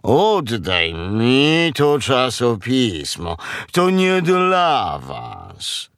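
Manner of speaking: very slow, drawn-out cow voice; reproving cow. Acted. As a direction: order it back in long mooing vowels. Cow demands the magazine back.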